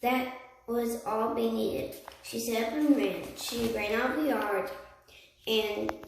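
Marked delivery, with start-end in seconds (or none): none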